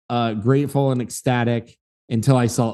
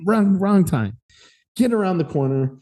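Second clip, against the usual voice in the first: high, winded retort; mock winded; 6 semitones higher than usual. Runner to a joking stranger, mock winded. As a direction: puff it out through a forced laugh. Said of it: "like, trying to fake laugh".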